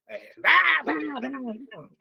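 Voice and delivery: high sputtering noises of someone losing control. Shown as a demonstration. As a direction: act out losing your temper with broken sounds. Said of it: "me descontrolo". (balbuceo agudo de alguien que pierde el control)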